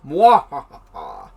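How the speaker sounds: loud slow evil cackle; mad scientist. Pretend. Evil laugh over new eye.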